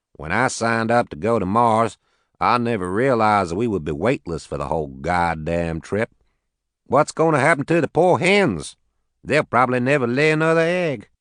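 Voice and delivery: lightly voiced Southern farmer; southern accent. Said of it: "He had a heavy southern accent".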